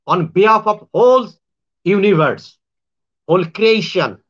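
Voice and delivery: ordinary talk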